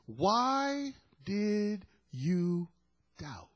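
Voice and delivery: very slow, each word stretched out; slow, lingering question falling to a hush; drawn-out question. Acted. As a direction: draw out WHY high, then let DOUBT drop away softly. Quotes Jesus' question without condemnation.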